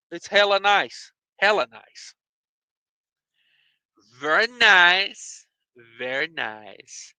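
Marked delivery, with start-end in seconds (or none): 4.2-5.5 slow, rising very nice; slow, lilting approval; drawn-out approval. Pretend. Repeats phrase in contrasting voice. 6.0-7.1 slow, low, soft very nice; softly savored, low and slow; hushed approval. Pretend. Repeats phrase in contrasting voice.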